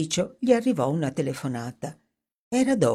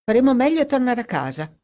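breezy and quick, brushing it off; offhand tone; 8 semitones higher, 4 dB louder, faster than usual. Acted. Dorothy to Edward, ending the talk, quick breezy offhand tone. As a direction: toss it off casually, higher and faster. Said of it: "disse in tono disinvolto".

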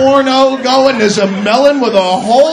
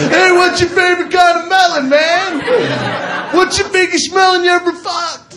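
eager bro; 7 semitones higher than usual. Retold or imitated. Fan cornering comic about melons, eager shout. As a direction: pitch it up like a thrilled new pal.

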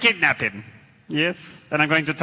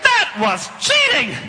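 mock outrage; 8 semitones higher than usual. Hit during fight.

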